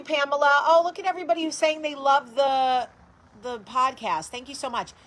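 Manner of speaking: light up reading their comments; excited thanks. Real, not acted. Reading supportive live comments excitedly.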